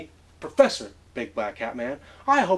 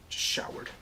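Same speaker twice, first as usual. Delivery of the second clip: whisper; 9 dB quieter than usual. Acted. Whispered reply.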